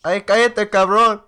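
put-on loud high Mexican accent; mock Mexican accent, shouted high. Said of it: "You have a very thick Mexican accent".

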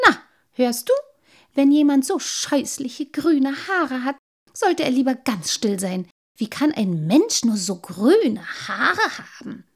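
affronted, emphatic telling-off; indignant. A narrator's reading. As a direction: scold loudly in disgust. Offended man insults green hair.

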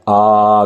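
long, held आ pushed from the belly; drawn-out आ with effort; tense long vowel. Shown as a demonstration. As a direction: sustain आ on a level note. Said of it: "पेट तक खिंचाव".

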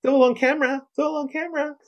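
high squeaky mocking driver voice. Hosts mock the dashcam driver in a high squeaky fake voice. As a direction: whine it like a smug driver. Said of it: "coming up with fake voices for him".

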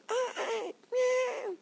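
very high-pitched kitten meow; imitated kitten cry. Storyteller mimicking a kitten's cry on the trail, high mewling. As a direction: squeal like a crying kitten. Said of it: "teriakan anak kucing".

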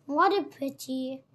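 ordinary delivery, nothing marked

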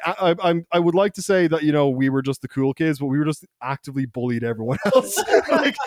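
ordinary talk

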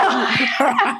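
high laugh after a joke; real laughter. Real, not acted. Guest laughing at her Queen joke with host. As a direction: laugh it off brightly.

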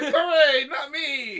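high, loud mock hooray; gleeful shouted imitation; mock cheering. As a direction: cheer loudly as if let off the hook. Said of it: "Ash is like".

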